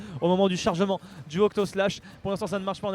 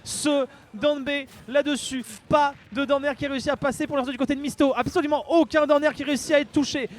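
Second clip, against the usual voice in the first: raised, fast hype commentary; live play-by-play; 6 semitones higher than usual. Acted. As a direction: call the action, pushing it higher.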